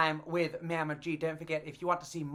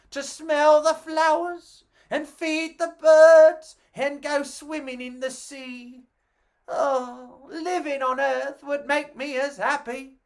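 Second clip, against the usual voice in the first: higher, wistful longing; dreamy alien Alan; 6 semitones higher, 3 dB louder than usual. Acted. Alan gazing down at Earth, higher and wistful.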